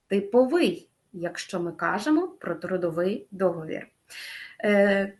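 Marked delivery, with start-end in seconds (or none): 0.1-0.9 "типовий" stressed on ВИЙ; stress on final syllable. Shown as a demonstration. Teacher models the contract sense, "типовий" with final stress. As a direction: land on the last syllable of "типовий". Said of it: "наголос на останній склад".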